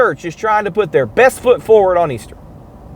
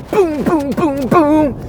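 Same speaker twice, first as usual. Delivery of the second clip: higher sung boom boom; mock keyboard boom; 6 semitones higher, slower than usual. Comedian imitating the keyboard player's beat, sung higher. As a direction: pound out the beat with your voice. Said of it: "you pound the keyboards".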